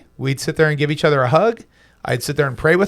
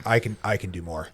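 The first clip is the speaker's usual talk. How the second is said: lightly voiced, low-pitched challenge; a hint of a defiant child, pitched low; imitating defiant daughter; 6 semitones lower than usual. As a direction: drop your voice to mimic a kid taking the dare. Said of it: "My daughter challenged it. She's like".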